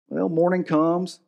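ordinary talk

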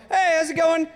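bright, high passing hello; breezy greeting. Retold or imitated. Preacher greeting someone in passing, breezy and high. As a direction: toss off a cheery hello in passing.

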